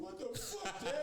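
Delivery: put-on way up high, a boy's squeal; Isaac squeals at his dad; squeaky kid's outrage. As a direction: squeal it like a shocked kid. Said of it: "Isaac's sitting there going".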